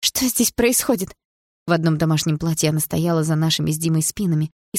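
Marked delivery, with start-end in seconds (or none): 0.0-1.2 high, startled question; frightened mother. A narrator's reading. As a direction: ask in alarm, drawn out slowly. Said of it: "раздался испуганный голос мамы". (испуганный голос мамы)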